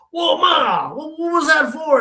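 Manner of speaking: high, loud startled yelp; shocked protest. Retold or imitated. Grandson just knocked down by grandmother, shocked shout. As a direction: cry out, stunned by the blow. Shocked after knuckle hit to forehead.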